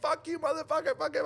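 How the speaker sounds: high-pitched mock tirade; imitated cursing rant. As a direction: imitate an angry man swearing, voice shot up. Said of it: "He starts cursing me".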